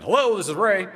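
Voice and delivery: loud, high New Jersey phone greeting; New Jersey accent. Retold or imitated. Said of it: "in his New Jersey accent".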